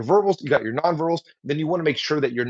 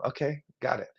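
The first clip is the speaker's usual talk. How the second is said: softer, smiling okay; soft acknowledgement; 8 dB quieter than usual. Shown as a demonstration. Listener smiling at a speaker, soft acknowledgement. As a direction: quietly show you're with them. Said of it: "Give a little smile".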